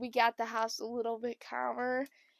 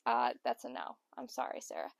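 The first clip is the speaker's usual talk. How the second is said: lower, firm mom voice, lightly voiced; a hint of a tired mother saying no; low, flat refusal in mom's voice; mock stern mom; 13 semitones lower, 8 dB quieter than usual.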